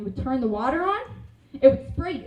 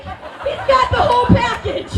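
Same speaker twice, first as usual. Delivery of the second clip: high, loud, slow and laughing; hyped; 8 semitones higher, 8 dB louder, slower than usual. Retold or imitated. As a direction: sell him like a prize catch. Hyping sister's crush.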